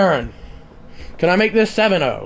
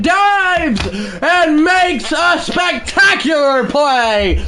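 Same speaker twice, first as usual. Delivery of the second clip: higher, shouted announcer call of the big play; excited play-by-play; 6 semitones higher, 4 dB louder than usual. Acted. Gamer calling the game-winning dive as announcer, shouting. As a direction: build to a shout on the diving catch.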